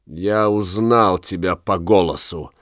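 ordinary delivery

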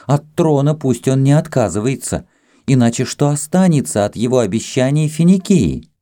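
high-pitched, insistent objection; exclaimed protest. A narrator's reading. Kama pulls away from Ramses, protesting. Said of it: "воскликнула Кама, вырываясь из его объятий".

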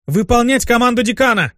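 high, forceful order; barked command. Acted. Succubus enforcing the dean's command, barking. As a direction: bellow it at the squad. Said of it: "рявкнула Лера".

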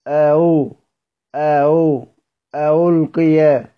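أو as a glide from hamza toward waw, then ألقي; eased hamza. Shown as a demonstration. Teacher breaks the word down, eased hamza on أو. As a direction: isolate the softened أو before completing ألقي. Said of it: "وتجعل المضمومة بين الهمزة والواو".

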